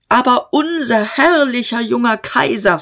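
high, pushed, menacing question; a threat dressed as a question; threatening question. Acted. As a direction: dare anyone to disagree. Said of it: "fragte er drohend".

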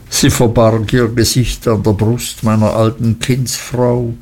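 plain reading voice

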